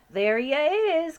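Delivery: loud, slow, high exclamation; excited cry. Acted. BFG overhearing the Sultan, excited cry. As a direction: cry out in recognition. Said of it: "cried the B F G".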